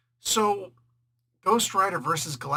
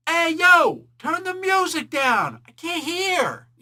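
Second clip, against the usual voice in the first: high, drawn-out holler over music; calling out; 13 semitones higher, 9 dB louder, slower than usual. Retold or imitated. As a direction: re-enact yelling across a noisy wedding. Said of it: "I called out in the wedding".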